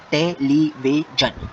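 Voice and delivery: slow, drawn-out टेलीविजन, each syllable apart. Shown as a demonstration. Teacher counting syllables for students, slow and even. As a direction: spell out टेलीविजन slowly in beats. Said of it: "कितने syllable हैं".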